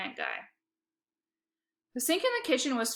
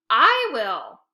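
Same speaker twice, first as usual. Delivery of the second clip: growl; 9 dB louder than usual. A narrator's reading. Sister growling at brother over who cleans. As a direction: push back like a rival. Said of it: "growled Lexi".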